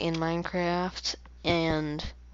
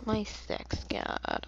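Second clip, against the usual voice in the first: embarrassed whisper; 4 semitones higher, 7 dB quieter, much slower than usual. Real, not acted. Embarrassed muttered phrase.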